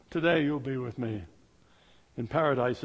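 low, soft reassurance; gentle Jesus. Acted. Jesus answering the thief, low and tender. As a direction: say it quietly, like a promise. Repeated softly again.

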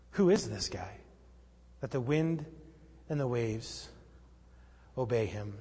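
low, hushed wonder in short phrases; quiet awe, sinking at the end; awed disciples. Acted. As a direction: marvel softly, pausing between phrases. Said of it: "the disciples say".

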